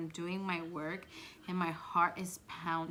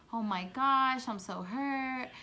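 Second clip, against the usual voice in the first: mock hurt; 6 semitones higher, 6 dB louder than usual. Retelling the teacher acting hurt.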